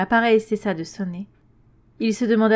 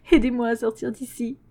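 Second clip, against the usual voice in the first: pleading; 4 semitones higher than usual. A narrator's reading. Xavier smeekt aan telefoon.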